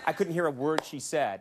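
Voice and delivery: normal talking voice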